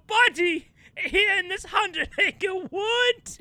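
high, earnest stage pleading; melodramatic actor. Acted. Honey-covered actor trusting the bear not to bite, hammy pleading. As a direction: confide grandly, stretching the phrases. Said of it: "he'll be like".

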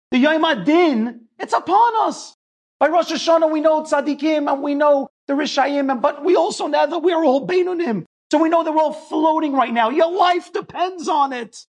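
high, loud, pressing sermon cry; preaching at full force. Acted. Rabbi exhorting the congregation before Rosh Hashanah, impassioned preaching. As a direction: rouse the room as the Days of Awe near.

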